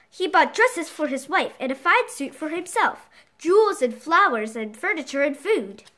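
plain reading voice

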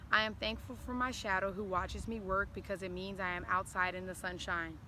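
ordinary talk

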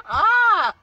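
high, loud laughing scream; a shriek mixed with a laugh. Retold or imitated. Struggle over the towel.

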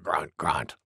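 very low, soft boar grunts; low piggy grunting; grunting wild boar. A narrator's reading. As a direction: grunt deep down after the sneeze. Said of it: "said Wild Boar".

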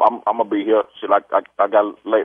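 ordinary delivery

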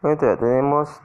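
ordinary talk

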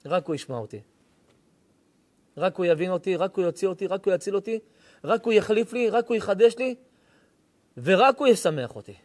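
stack the phrases into a crescendo; building litany. Acted. Preacher declaring only God saves, rising preaching crescendo.

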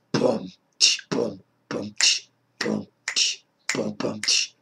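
vocal beatbox, boom and tch sounds; beatbox intro. Acted. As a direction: drop a beat with your mouth.

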